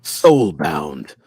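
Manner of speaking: low, loud, drawn-out announcer call; booming announcer voice. Pretend. Host playfully calling out a badge name, booming announcer voice. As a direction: boom it out like a game announcer.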